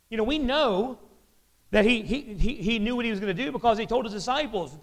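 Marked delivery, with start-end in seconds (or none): none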